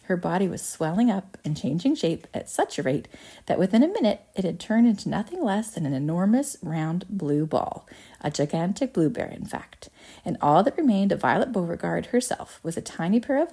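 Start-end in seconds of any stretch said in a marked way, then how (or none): none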